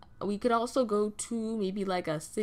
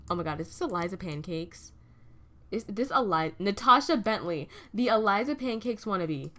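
normal talking voice

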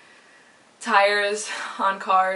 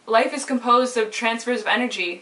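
ordinary delivery

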